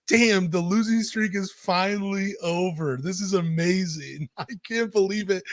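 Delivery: breaking into a happy laugh; overjoyed disbelief. Real, not acted. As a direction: savor the relief, voice lifted high. Losing streak finally over, joy.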